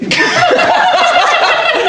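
high-pitched peal of laughter; burst of laughter. Real, not acted. Cracking up at the quiz answer, high burst of laughter.

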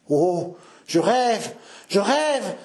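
slow, rising, wondering exclamation; overcome old man. Acted. Dying father hearing of the midnight wedding, overcome with wonder. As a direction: marvel as if in a dream.

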